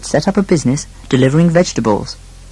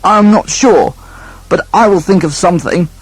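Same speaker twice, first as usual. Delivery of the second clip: higher, flustered character voice; flustered brother voice; 5 semitones higher, 3 dB louder than usual. Acted. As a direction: bluff through uncertainty. Eldest son unsure, voiced.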